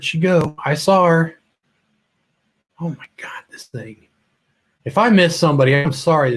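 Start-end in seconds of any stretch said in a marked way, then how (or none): 2.8-4.1 breathless unvoiced whisper under the breath; a real whispered aside, half to herself. Real, not acted. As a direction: mutter it to yourself, barely voiced, at the runaway controls. Fumbling with moving controls live.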